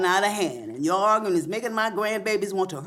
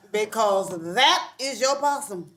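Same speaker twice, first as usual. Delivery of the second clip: usual speaking voice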